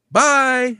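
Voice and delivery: high, cheery mock goodbye; happy farewell. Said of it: "he's happy".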